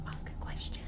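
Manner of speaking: urgent hushed whisper; whispered instruction. Retold or imitated. Friend whispering advice during the ghost hunt, hushed. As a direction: breathe it out under your breath.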